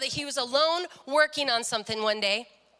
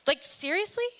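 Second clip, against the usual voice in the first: rising, higher-pitched disbelief; incredulous question; 5 semitones higher than usual. Retold or imitated. Daughter questioning her dad's friend's conversion, incredulous. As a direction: ask it as if you can't believe it.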